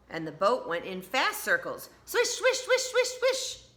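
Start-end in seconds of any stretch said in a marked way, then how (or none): 2.0-3.6 very high swooping swishes; giddy swishing. Acted. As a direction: spin it out playfully. Fast circles, laughing.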